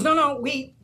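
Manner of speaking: sudden high, loud correction; a homeless man abruptly changing his mind; quoted customer. Retold or imitated. Retelling a man at the bread line, loudly changing his order.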